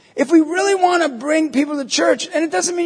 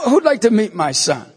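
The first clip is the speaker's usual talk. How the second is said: normal talking voice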